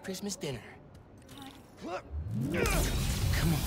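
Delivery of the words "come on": strained high shout of effort; straining effort. Real, not acted. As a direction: push it out with effort. Straining to power the lights.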